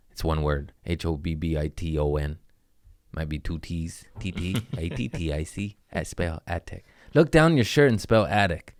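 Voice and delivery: ordinary talk, nothing marked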